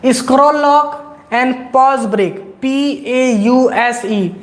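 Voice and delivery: slow, spaced-out dictation. Shown as a demonstration. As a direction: dictate so students can write it down. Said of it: "note कर सकते हो". (नोट कराने के लिए धीमे-धीमे बोलना)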